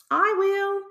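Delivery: very high squeaky little mouse; tiny mouse voice. Acted.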